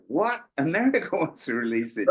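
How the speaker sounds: sudden high "What?" then amused, laughing disbelief; retold astonishment. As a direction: relive the shock with a laugh. Retelling shock at America's interest.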